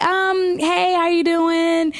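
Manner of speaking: fake-sweet greeting to escape; awkward politeness.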